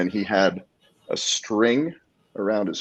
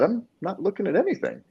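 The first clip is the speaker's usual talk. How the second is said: high-pitched protest of innocence; retold innocent denial; 12 semitones higher than usual. Telling the glaring stranger he meant nothing, innocently. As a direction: re-enact a harmless denial, voice way up.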